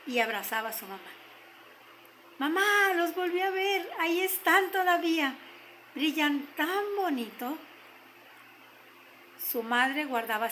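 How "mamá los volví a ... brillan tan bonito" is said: put-on excited child's outburst, pitched higher at the start; excited little girl. A narrator's reading. Girl telling her mother the skates are still there, excited. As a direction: gush to mamá like a delighted child.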